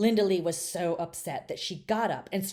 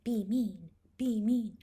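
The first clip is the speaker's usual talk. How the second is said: inner voice; 5 semitones higher, slower than usual. A narrator's reading. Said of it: "a little voice in her head would say".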